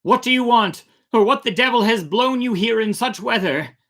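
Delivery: loud, high hail across the water; calling out. Acted. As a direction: shout the challenge out to the boat. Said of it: "Tom Willis called out".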